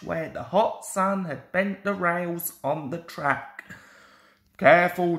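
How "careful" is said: louder warning call. A narrator's reading. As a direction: warn him sharply. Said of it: "called his driver".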